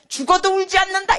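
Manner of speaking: high, loud declaration; retold defiant vow. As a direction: proclaim it stubbornly, as a quote. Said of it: "이랬어요". (높고 크게 재연한 다짐)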